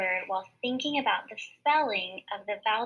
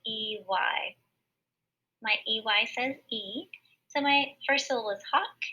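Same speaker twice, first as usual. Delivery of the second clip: ordinary talk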